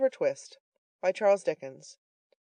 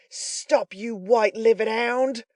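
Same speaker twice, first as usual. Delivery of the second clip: louder, higher, slow shout; furious shout; 6 semitones higher, 7 dB louder, slower than usual. A narrator's reading. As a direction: yell after the fleeing man. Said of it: "cried the robber".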